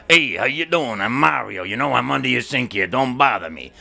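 gruff-mannered Brooklyn Italian brush-off; curt Brooklyn Italian working-guy accent; Brooklyn Italian plumber. Shown as a demonstration. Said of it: "what does that sound like?".

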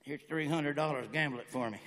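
low, hushed mock request; imitated buddy. Buddy asking a Las Vegas favour, low and quiet. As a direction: murmur it low, like a private favour. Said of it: "a buddy of mine going like this".

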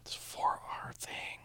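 slow whisper. Acted. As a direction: whisper it slowly. Mock quarrel in whispers.

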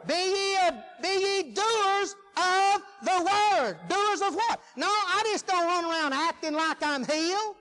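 loud, high scripture proclamation; forceful preaching. Acted. Preacher quoting scripture to congregation, forceful preaching. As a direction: proclaim the verse loud, stretching the key words.

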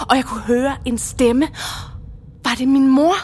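højt, opbygget til forbløffet udbrud; dramatisk fortælling. Acted. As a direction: lad undringen vokse frem mod et udbrud. Dramatisk åbenbaring af morens stemme. (højstemt og bevæget)

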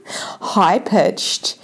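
ordinary talk, nothing marked

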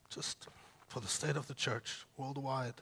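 quiet, voice catching with real tears; choked up. Real, not acted.

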